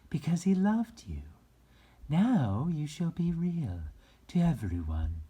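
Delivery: high, loud, bright fairy voice; high sweet fairy speaking to the rabbit. A narrator's reading. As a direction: lift it way up into a fairy's voice. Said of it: "the fairy said".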